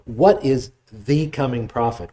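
ordinary talk, nothing marked

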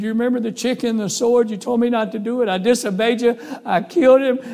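high, urgent outpouring; desperate confession. Retold or imitated. Boy confesses to his dad.

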